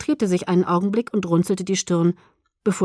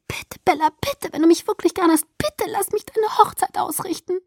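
high-pitched, imploring begging; wheedling, earnest plea; pleading; 12 semitones higher than usual. A narrator's reading. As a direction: beg a friend in a high little voice. Alice begs to plan the wedding.

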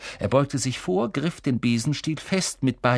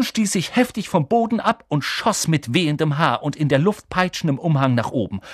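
ordinary delivery, nothing marked

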